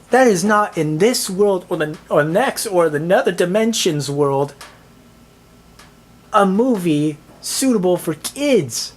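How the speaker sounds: ordinary delivery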